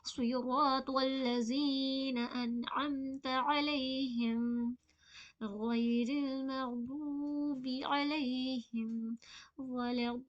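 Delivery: slow melodic recitation; Quranic recitation. Shown as a demonstration. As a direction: recite it in long measured phrases. Recites the verse.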